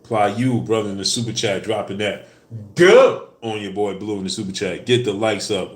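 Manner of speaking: loud, pumped-up thanks to a fan; hyped shoutout. Real, not acted. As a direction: call it out loud like a hype man. Hyped super chat shoutout.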